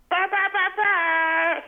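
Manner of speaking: high, loud horn blasts; air horn imitation. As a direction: honk it like a party air horn. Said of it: "we're just like".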